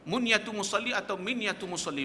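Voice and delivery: ordinary talk, nothing marked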